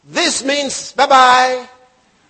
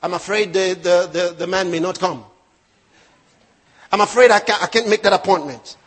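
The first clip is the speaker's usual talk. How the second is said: lower-pitched, hesitant mock apology; imitated worried excuse; 7 semitones lower than usual. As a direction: stumble over the excuse, mimicking fearful talk. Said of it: "some people talk about that".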